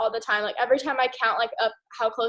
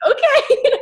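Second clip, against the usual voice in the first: much higher, louder squeal of agreement; excited okay; 13 semitones higher, 8 dB louder than usual. Retold or imitated. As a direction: burst with eager yes. Fired up after Shay's pep talk.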